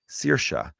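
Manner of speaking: Saoirse drawn out as SEER-sha; SEER-sha pronunciation. Shown as a demonstration. Podcaster using his cousin's daughter's pronunciation, Saoirse said SEER-sha. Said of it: "the way my cousin's daughter's name is pronounced".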